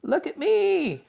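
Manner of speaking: very high, slow gleeful yell; shout. A narrator's reading. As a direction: crow it while running off. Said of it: "he shouted as he ran".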